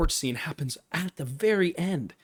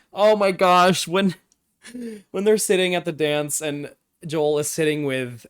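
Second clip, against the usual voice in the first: excited; 3 semitones higher, 7 dB louder than usual. Real, not acted. Excited recap of dance scene.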